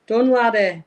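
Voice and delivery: put-on loud, playful Scottish accent. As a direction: call it out like a jokey Scot. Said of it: "doing a Scottish accent".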